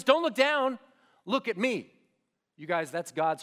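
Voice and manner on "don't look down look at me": higher, louder urgent call; movie-style urgent shout. Retold or imitated. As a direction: yell it like the big movie rescue moment. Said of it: "what they do in the movies".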